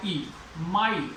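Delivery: "माई" drawn out slowly, gliding आ into ई; teacher slowly demonstrating the diphthong; slow model word. Shown as a demonstration. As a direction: stretch "माई" so the glide from आ to ई is clear. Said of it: "ले लेते हैं माई".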